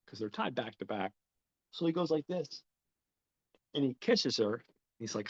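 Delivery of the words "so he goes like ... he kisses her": plain reading voice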